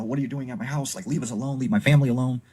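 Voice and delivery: rising mock yell at the intruder; half-acted angry yell into the dark; retold yelling. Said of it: "he starts screaming".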